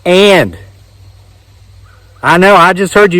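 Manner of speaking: ordinary talk, nothing marked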